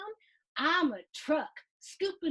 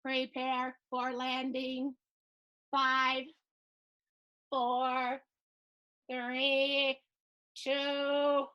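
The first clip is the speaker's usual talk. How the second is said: slow monotone robot countdown; much slower than usual. Acted. Boy warning bus crew before landing, robot voice. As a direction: count down like a robot, evenly spaced. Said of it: "in your robot voice".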